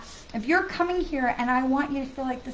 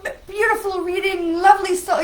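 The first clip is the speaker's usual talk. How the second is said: higher, louder, syrupy fake-sweet voice; mock spammer flattery; 6 semitones higher, 9 dB louder than usual. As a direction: ham up a fawning scam message. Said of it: "like, say".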